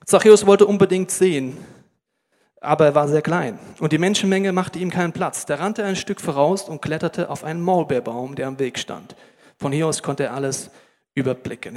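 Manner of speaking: loud, measured Bible reading; solemn scripture read to the congregation. Acted. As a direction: read the passage like a proclamation. Bible passage read aloud.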